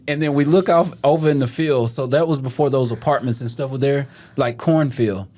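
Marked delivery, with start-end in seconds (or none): none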